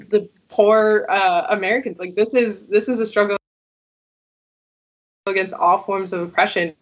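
choppy, cutting-out connection; words dropping out on a bad line; glitching audio. Real, not acted. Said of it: "Your audio is cutting, uh, a little bit".

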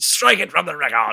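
hammed-up demand; mock courtroom echo. Echoes the mock courtroom order.

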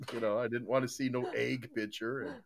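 ordinary talk

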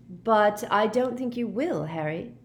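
slow, careful doubt; slowly. A narrator's reading. Hermione slowly doubting Harry's plan, slowed. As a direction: draw it out, weighing each word. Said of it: "said Hermione slowly".

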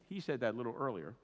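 usual speaking voice

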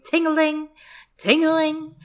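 high, ringing sing-out; imitated bell jingle. Reader imitating the bells on a fool's helmet, jingling. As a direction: chime it like little bells. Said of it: "bells on his antlered tin helmet going".

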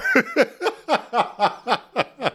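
a long high peal of laughter. Real, not acted. Teacher laughing at her own extreme example. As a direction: crack up helplessly.

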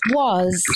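"Soise" said by itself, like "swaz"; second syllable alone. Shown as a demonstration. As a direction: sound out "Soise" as one separate syllable, "swaz". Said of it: "that is the second syllable".

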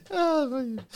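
squeaky oh man. Real, not acted. Laughing fit aftermath.

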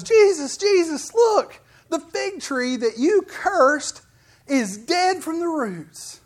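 high, loud excited call to Jesus; excited Peter. Retold or imitated. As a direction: Peter blurting it out in amazement, slowing at the end. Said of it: "he goes".

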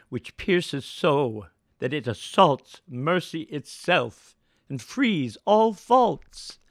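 slow, loud Shakespearean declamation, every line weighed; an old magician begging the audience for release, slow and loud; Prospero's epilogue. Acted. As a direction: deliver the closing plea to the audience as on stage, slow and full-voiced. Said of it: "I'm gonna ask you to do".

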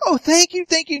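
high, loud gushing thanks; breathless relieved victim; grateful woman. Retold or imitated. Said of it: "The woman's like".

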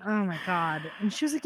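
ordinary talk, nothing marked

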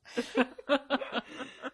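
bright burst of real laughter; cracking up at the recap. Real, not acted. Host cracks up at her own recap, laughing.

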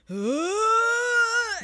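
long, loud, high rising groan; hammed-up winded moan, swelling loud; imitated noise. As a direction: imitate a fighter groaning back to life. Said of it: "she makes this noise".

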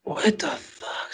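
slow, hushed disbelief; stunned whisper. Real, not acted. Stunned reaction to Boris's American birth.

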